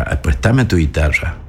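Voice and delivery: normal talking voice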